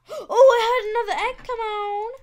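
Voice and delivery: high, loud startled outburst; startled exasperation. Real, not acted. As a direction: cry out as it happens again. Reacting to another egg appearing.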